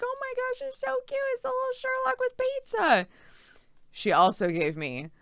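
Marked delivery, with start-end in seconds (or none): 0.0-3.1 much higher, gushing squeal; fawning squeal. Retold or imitated. Fawning over the cute cat pins, a high gushing squeal. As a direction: squeal over something adorable. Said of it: "fawning over this and being like".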